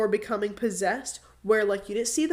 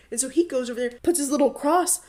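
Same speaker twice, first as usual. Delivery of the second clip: ordinary talk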